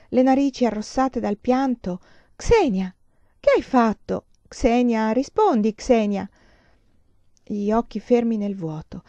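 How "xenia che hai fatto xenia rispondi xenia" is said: loud, anxious demand for an answer; worried, raised-voice pleading; alarmed urging. Acted. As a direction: press a silent friend to respond.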